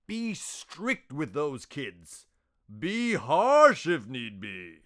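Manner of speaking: slow, swooping ringmaster with high, stretched stresses; high-pitched swoops, slow and emphatic; booming ringmaster. Acted. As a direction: play a grandiose boss, drawing out each key word. Voices the ringmaster.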